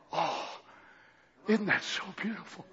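soft, low and tender with wonder; God hushed in awe. Acted. God telling the angels how beautiful it sounds, hushed. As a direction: sigh it out in quiet amazement. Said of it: "and say".

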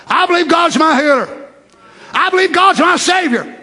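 high, forceful declaration of faith; out loud. Shown as a demonstration. Preacher shows congregation how to confess faith, said out loud. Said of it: "No, say it out loud".

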